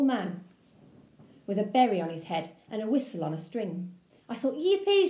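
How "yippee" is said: excited yippee. Acted. Excited at visitor.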